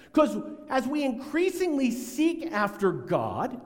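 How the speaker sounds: ordinary talk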